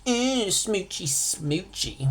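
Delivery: put-on high, drawn-out childish "Ew, smoochy"; mock disgust. Teacher mocking a kid grossed out by romance, high and squeamish. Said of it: "This is no gross".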